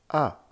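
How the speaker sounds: A short, high and falling, loud; "A" dry and emphatic, starting high; fourth tone. Shown as a demonstration. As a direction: drop "A" curtly from the top. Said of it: "Avec les quatre tons".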